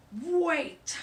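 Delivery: loud menacing bark; scary voice. A narrator's reading. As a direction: stop them cold. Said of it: "said a scary voice".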